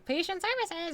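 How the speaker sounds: prim answer, up in pitch; imitating a receptionist. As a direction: mimic the operator answering the line. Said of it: "you hear".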